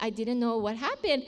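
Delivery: ordinary talk, nothing marked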